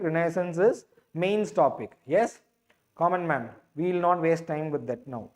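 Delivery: ordinary talk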